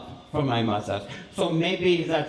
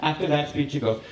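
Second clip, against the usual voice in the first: usual speaking voice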